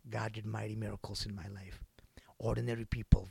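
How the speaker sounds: soft whispered testimony. Acted. Preacher to congregation, whispering testimony. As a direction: whisper it in wonder.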